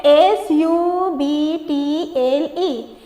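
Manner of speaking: very slow letter-by-letter reading; spelling out. Shown as a demonstration. Teacher reading the seventh word's spelling, spelling out. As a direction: spell it with each letter drawn out. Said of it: "इसका spelling पढ़ते हैं".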